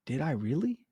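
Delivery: soft surprised question; a touch of sheepish surprise; quiet disbelief. Retold or imitated. Client Naomi caught out by the realtor, softly surprised.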